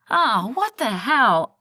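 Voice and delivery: loud, slow, exasperated outburst; annoyed yell. A narrator's reading. Finding a parking ticket, loud annoyed yell. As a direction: let frustration burst out loud. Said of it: "I yelled".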